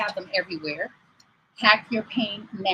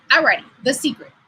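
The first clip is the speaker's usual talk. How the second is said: normal talking voice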